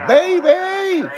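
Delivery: high sing-song call to a pet bird; high falling call to his bird. Real, not acted. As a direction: call out high to get the bird's attention. My bird's screaming for me.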